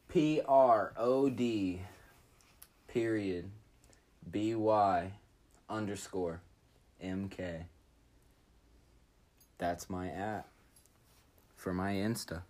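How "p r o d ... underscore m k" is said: dictating letter by letter; slow spelling out. Shown as a demonstration. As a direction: spell each letter slowly and clearly.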